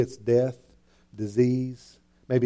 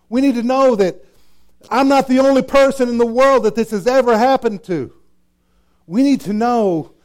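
higher and louder, preaching out; full-force preaching; 8 semitones higher, 7 dB louder than usual. Acted. Preacher rousing the congregation, full force. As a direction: lift the church with it.

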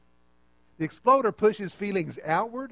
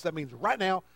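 ordinary talk

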